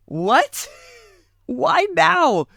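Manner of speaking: imitating her own baffled reaction; retold disbelief. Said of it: "I literally went".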